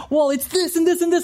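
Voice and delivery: high-pitched rush of complaints, a hammed-up blowup; exasperated venting. To the person who asked what's wrong, a hammed-up high exasperated outburst. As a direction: spill every grievance at once in a high put-on voice.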